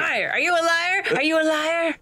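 high accusing voice. Acted. Acting accusing boyfriend.

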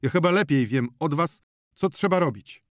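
irritated doctor putting someone down; angry shout. A narrator's reading. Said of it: "krzyknął doktor".

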